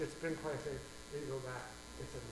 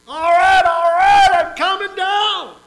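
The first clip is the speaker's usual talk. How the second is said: high, loud shout of surrender; exasperated giving in; 24 dB louder, slower than usual. Acted. Worn-out neighbor giving in to the persistent knocker, loud. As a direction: yell that you give up. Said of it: "He finally says".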